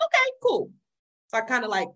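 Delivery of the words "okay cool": high squeaky okay. Retold or imitated. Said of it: "So I was like".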